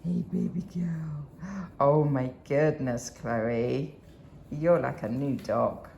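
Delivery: soft, slow cooing to a dog. Real, not acted. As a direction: coo gently so she stays calm.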